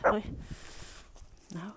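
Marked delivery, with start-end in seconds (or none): none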